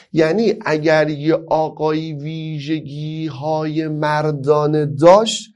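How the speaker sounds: ordinary talk, nothing marked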